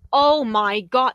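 overwhelmed by the doves reveal; excited outburst. Real, not acted. Fan reacting to the doves, slow excited gasp.

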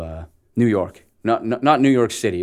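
ordinary talk, nothing marked

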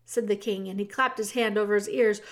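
plain reading voice

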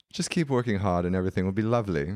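put-on quick, reassuring British accent; motherly British lilt, quickly delivered; middle-aged British woman. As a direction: imitate a British woman briskly consoling. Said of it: "a British woman, like, a middle-aged British woman".

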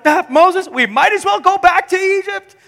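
high, whiny panicked plea; hammed-up terrified complaining; panicked Israelites. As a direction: wail at Moses like a frightened crowd. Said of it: "they're so terrified of this that they're like".

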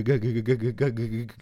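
ordinary delivery

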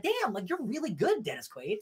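high-pitched amazed praise; acted-out fan astonishment; impressed gushing. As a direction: gush it, voice shooting up in surprise. Acting out impressed reaction to actor.

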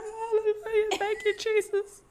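high, loud hallelujah praise from the mama; mock praise shout. The mama praising over her dog, high excited praise. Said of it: "the mama and the woman was just like".

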